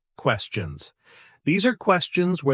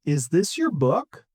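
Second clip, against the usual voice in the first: "book" rising at the end; rising intonation. Shown as a demonstration. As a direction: lift "book" for a yes-no question. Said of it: "Time for another repeat after me".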